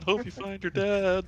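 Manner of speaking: Buddy the Elf impression; cheery Elf farewell. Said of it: "You gotta say it like Elf".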